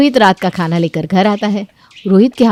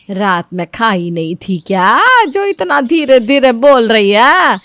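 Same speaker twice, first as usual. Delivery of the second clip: slow, sarcastic mother-in-law; mocking taunt; 3 semitones higher, 4 dB louder, slower than usual. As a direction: drag out the jibe.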